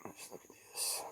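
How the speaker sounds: hushed whisper, breath only; a soft whisper over the new knife. Real, not acted. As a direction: whisper it as you lean in to look. Inspecting the gift close up.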